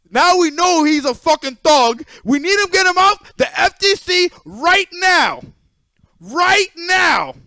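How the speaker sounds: loud, high, slow hyped alarm; mock outrage. Telling chat the thug must be removed, loud hammed-up alarm. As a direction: ham up the shock for the chat.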